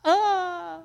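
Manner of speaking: strangled high "ah, ah", imitating his shock; a mock choked gasp of disbelief; retelling how he reacted to the engagement, strangled high "ah"; stunned reaction. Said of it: "And I'm like".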